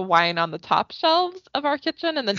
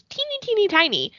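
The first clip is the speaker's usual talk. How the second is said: high-pitched emphasis on smallness; tiny high voice; 12 semitones higher than usual. Real, not acted. Host describing her small strong friend, high tiny voice. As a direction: squeak it up high to show smallness.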